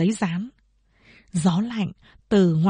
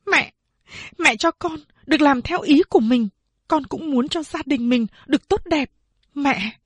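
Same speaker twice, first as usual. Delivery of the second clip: firm resolve; 6 semitones higher than usual. A narrator's reading. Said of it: "rất kiên quyết nói".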